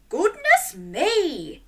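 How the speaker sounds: high, drawn-out exclamation; startled farmer. A narrator's reading. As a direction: exclaim slowly with a swooping high pitch. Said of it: "The farmer woke and said".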